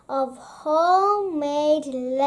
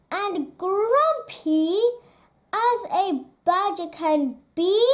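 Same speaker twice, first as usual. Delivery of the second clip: ordinary delivery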